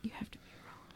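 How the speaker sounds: hushed whisper. Real, not acted. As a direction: breathe it under your voice. Whispered jab in argument.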